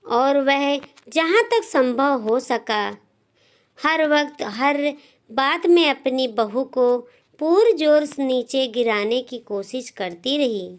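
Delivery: usual speaking voice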